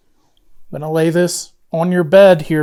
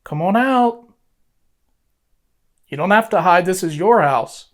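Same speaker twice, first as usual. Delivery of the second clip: talking to a ghost; 2 semitones higher than usual. Real, not acted. Coaxing spirit to move doll.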